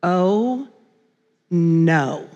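slow, drawn-out dread on each word; a heavy, halting realization, imitating a husband; dismayed husband. Husband spots trouble with the tickets, retold slow dismay. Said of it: "I hear these words from the passenger seat".

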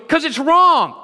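high, loud pleading protest; mock alarmed protest. Stranger pleading with the preacher, mock alarm. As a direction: blurt it out in alarm.

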